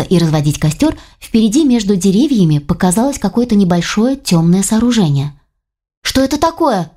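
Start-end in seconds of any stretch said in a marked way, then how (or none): 6.0-6.9 high, loud call asking what it is; loud question. Acted. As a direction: call out to the group pointing ahead. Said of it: "громко спросила Лиля".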